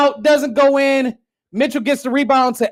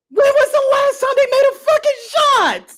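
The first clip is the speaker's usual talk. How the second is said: much higher, strained repeat; squeaky incredulous cry; high pleading wail; 13 semitones higher than usual. Real, not acted. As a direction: beg the question in disbelief. Repeated question.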